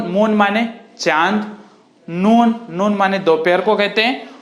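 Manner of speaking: ordinary talk, nothing marked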